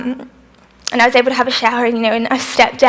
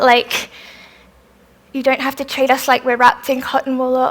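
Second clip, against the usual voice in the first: usual speaking voice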